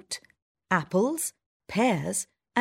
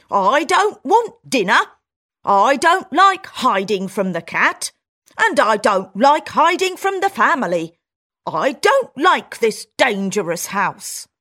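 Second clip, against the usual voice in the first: louder, fed-up little mouse; country mouse complaining; 4 semitones higher, 7 dB louder than usual. Acted. Country mouse telling her friend why she's leaving, loud and fed up. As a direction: list every grievance.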